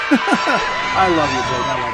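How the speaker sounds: loud, slow words through laughter; real belly-laughing delivery; laughing affection. Real, not acted. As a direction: crack up while saying it. Cracking up at chat.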